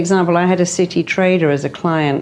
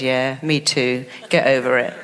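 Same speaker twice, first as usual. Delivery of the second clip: sarcastic mind; 6 semitones lower, 4 dB quieter than usual. Retold or imitated. The mind answering a wish, in a low dry put-down. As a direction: let the mind shrug it off dryly. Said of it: "it says".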